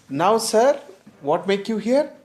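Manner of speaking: high, loud mock-polite question; ironic, needling greeting; sarcastic sir, pitched high. Shown as a demonstration. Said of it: "It's very sarcastic, ironical".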